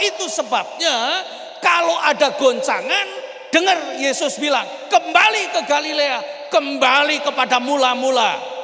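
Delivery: fiery preacher's climax, shouting; preaching at full force. Acted. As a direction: thunder it out to the congregation.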